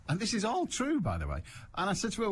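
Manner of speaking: ordinary delivery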